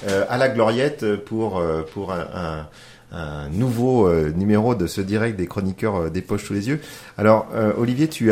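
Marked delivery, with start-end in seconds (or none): none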